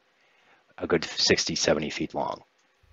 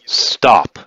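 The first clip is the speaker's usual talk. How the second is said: higher, louder in-character command; a short raised shout as a character; acted yell; 4 semitones higher, 6 dB louder than usual. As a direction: yell it to halt an attack. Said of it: "She yells".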